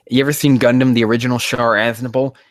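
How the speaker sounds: ordinary talk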